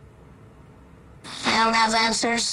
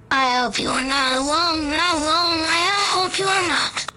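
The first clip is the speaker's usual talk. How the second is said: rising plea; 6 semitones higher than usual. Acted. Pleading not to be alone.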